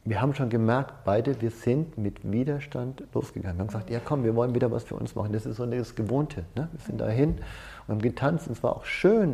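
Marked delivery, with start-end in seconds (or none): none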